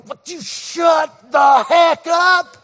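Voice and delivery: slow, high shouted inner voice; his own brain yelling at him mid-sentence, slow high shout. Retold or imitated. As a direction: yell at yourself to shut up, drawn out. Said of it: "your brain's going".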